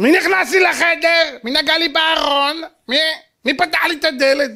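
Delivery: imitation of an outraged kid; mock indignant child. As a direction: whine angrily about an invaded room. Mimics today's child guarding his room.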